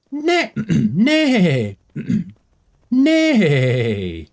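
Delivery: high, loud horse neigh; whinny like a horse; horse impression. Streamer imitating a horse for chat. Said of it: "you trying to impersonate a horse".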